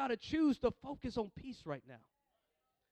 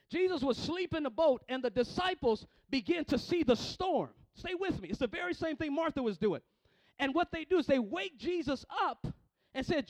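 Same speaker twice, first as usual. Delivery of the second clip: loud, driving sermon delivery; full-force preaching; 4 semitones higher, 7 dB louder than usual. Real, not acted. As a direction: build repeated phrases like a fired-up preacher.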